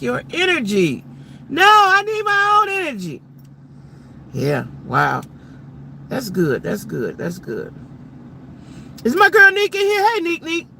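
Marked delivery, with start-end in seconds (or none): 1.5-3.2 high, loud and firm; emphatic declaration. Real, not acted. Reading a viewer's point, then a loud high insistence. 9.0-10.7 high, loud excited call. Real, not acted. Spotting a viewer in the chat, a high excited call-out.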